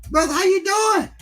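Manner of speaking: high mock-friendly hello; high friendly greeting. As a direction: call out high and cheery to a stranger. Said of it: "I said".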